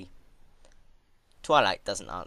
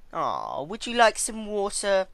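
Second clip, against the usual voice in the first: higher, louder, sugary false kindness; mock-sweet offer; 12 semitones higher, 7 dB louder, slower than usual. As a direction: coo it like a nurse who means harm. Twilight offering water mockingly.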